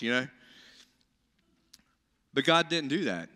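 ordinary talk, nothing marked

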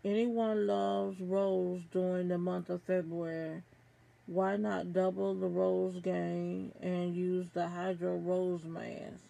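ordinary talk

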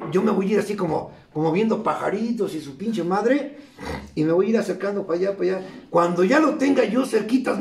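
ordinary delivery

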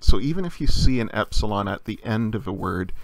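normal talking voice

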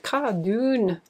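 Cardoon. in a hammed-up Scottish accent, rolled r and falling; mock Scottish accent. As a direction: play at sounding Scottish on Cardoon. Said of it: "Sorry, Scotland, for my appalling accent".

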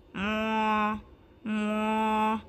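drawn-out mooing; cow moo. Acted. Narrator voicing the cow again, gentle moo. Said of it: "The cow said".

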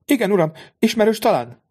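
high, lightly questioning lady's voice; a noble mother asking politely; curious lady. A narrator's reading.

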